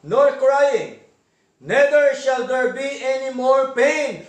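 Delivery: measured, weighty reading of the verse; preacher reciting slowly with emphasis; slow emphatic scripture. Acted. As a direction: lean into each word of the promise. Reads scripture slowly, louder.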